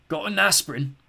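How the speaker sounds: put-on a sick old creature begging; cracked creature voice. Skellig asking the boy for aspirin, cracked.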